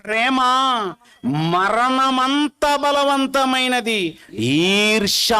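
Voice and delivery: solemn Bible reading; scripture reading. Acted. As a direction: intone the verse slowly.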